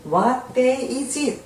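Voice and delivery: very slow, every word laid out; slow model reading. Shown as a demonstration. Teacher to Korean learners, slow model reading. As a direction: spell it out slowly for learners. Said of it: "teacher models English question".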